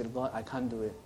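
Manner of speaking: hushed, humble prayer of weakness; whisper-soft admission to God; soft plea. Acted. Believer surrendering to God, soft humble plea.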